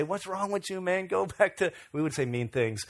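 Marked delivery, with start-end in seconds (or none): 0.0-1.7 higher-pitched mock taunt; retold taunting. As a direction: imitate teenagers clowning a friend. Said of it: "We would clown him and say".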